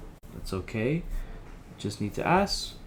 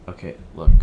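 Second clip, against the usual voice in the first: sudden loud okay look; 3 semitones lower, 11 dB louder, faster than usual. Acted. Helpline worker blurts loudly to calm an angry caller. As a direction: blurt it out louder, defensive.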